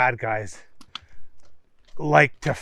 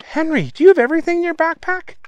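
high, quick, incredulous; amazed question; 13 semitones higher, 5 dB louder, faster than usual. A narrator's reading. Family looking at Henry's backpack, amazed high question. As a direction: pitch it way up in astonishment.

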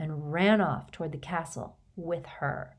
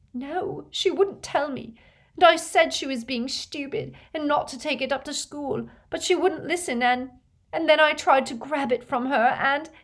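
high, tearful voice, growing louder; despairing, near wailing; 9 semitones higher, 4 dB louder than usual. A narrator's reading. Leanne telling how she grabbed at it, despairing. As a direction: build towards a wail of despair. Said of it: "Leanne let out a wail of despair".

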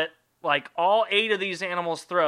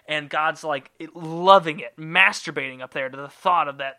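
normal talking voice

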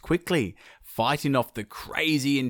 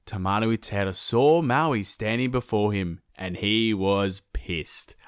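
usual speaking voice

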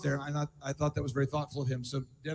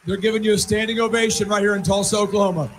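shouting over applause. Real, not acted. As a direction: raise your voice above a standing ovation. Host shouting over standing ovation.

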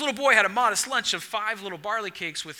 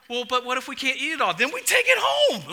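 imitating wife; 6 semitones higher, 4 dB louder than usual. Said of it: "and she's just like".

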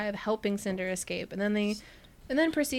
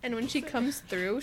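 ordinary talk, nothing marked

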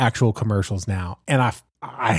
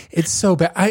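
ordinary talk, nothing marked

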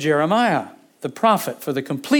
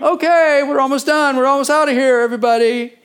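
high, loud, giddy call to everyone; packing-up glee, much higher and louder; mock excited announcement; 8 semitones higher, 5 dB louder than usual. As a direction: ham up the careless cheer. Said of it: "That is not a man going".

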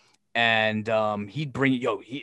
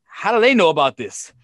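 incredulous; 5 semitones higher, 7 dB louder than usual. Retold or imitated. Incredulous quote, loud.

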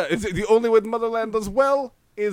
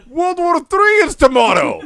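loud shout; 9 semitones higher, 8 dB louder than usual. Pretend. Sudden dramatic announcement.

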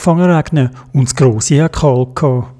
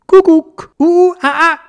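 much higher, louder squawky cuckoo; Dodo bird call; 14 semitones higher, 4 dB louder than usual. A narrator's reading. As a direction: call down teasingly like a cheeky bird. Dodo calls from the tree. (hoher, frecher Vogelruf)

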